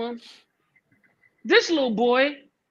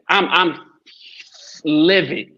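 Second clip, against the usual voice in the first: normal talking voice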